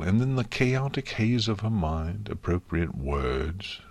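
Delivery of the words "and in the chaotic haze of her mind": usual speaking voice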